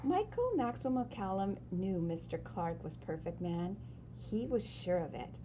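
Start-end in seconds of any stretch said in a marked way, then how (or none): none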